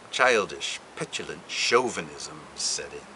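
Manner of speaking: ordinary talk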